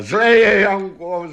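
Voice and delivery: high, loud warning cry. A narrator's reading. Magic horse warning Janko of the chasing witch, crying out. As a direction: call out the alarm, pitched high. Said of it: "zvolal tátoš".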